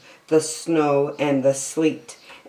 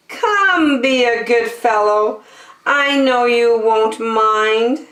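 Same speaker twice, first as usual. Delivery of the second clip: high, sweet wheedling bird voice; high coaxing bird; 6 semitones higher than usual. Acted. Lazy bird sweet-talking the elephant, high. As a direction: flatter him into saying yes.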